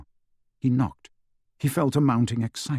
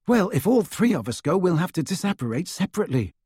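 put-on higher, quicker boyish character voice; lightly voiced higher-pitched Ron; Ron's voice; 7 semitones higher, faster than usual. A narrator's reading. Ron pointing out a problem to his friends, higher-pitched. Ron argues over the plan.

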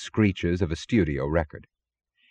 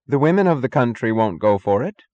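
raised, higher declaration; declaring; 5 semitones higher, 4 dB louder than usual. A narrator's reading. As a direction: declare it as a spokeswoman. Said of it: "declared Ma Chalmers".

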